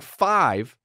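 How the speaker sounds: normal talking voice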